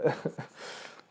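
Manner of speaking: short breathy laugh; brief snicker; amused chuckle. Real, not acted. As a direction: chuckle to yourself mid-reading. Chuckles at Esau's bitter cry.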